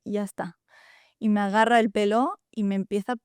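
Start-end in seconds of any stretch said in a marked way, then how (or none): 0.0-0.6 breathy whisper, almost no voice; a defeated whispered ya está; whispered plea. Retold or imitated. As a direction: whisper it, worn out and giving up. Begging as father rushes at her.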